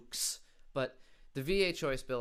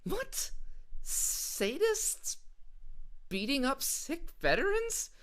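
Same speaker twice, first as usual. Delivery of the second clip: very high, squeaky disbelief; baffled, picking the claim apart; incredulous; 8 semitones higher than usual. Real, not acted. Comedian reacting to the veterans rant, shrill disbelief.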